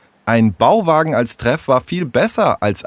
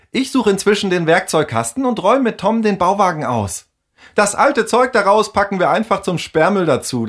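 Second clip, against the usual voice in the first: put-on much higher, bright girl handing out tasks; bossy girl Tessa; 11 semitones higher than usual. Tessa tells Henry and Tom what to fetch, high and brisk girl's voice. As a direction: take charge like the clever girl of the gang.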